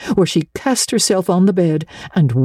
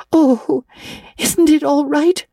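put-on anxious girl; 13 semitones higher, slower than usual. A narrator's reading. Anne anxious.

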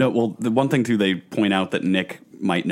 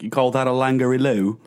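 higher, hammed-up Aussie challenge; mock Australian accent; 4 semitones higher, 3 dB louder than usual. Guest riffing on Crocodile Dundee, mock Australian accent. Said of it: "like, an Australian almost".

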